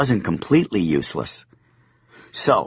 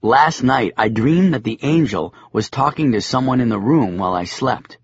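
ordinary delivery, nothing marked

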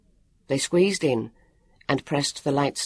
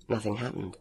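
plain reading voice

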